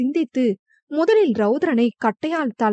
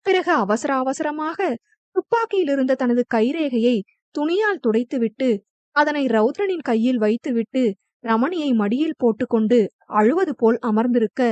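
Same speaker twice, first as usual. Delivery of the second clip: plain reading voice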